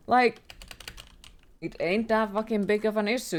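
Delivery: ordinary delivery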